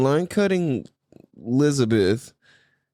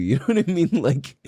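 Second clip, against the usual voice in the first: ordinary delivery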